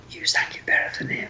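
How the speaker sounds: hushed, voiceless whisper; confiding under your breath; conspiratorial whisper. Retold or imitated. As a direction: whisper it like a secret behind someone's back. Bandmates' secret verdict after singer leaves.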